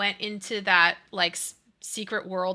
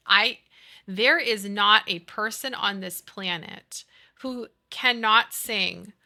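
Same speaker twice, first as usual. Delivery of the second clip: normal talking voice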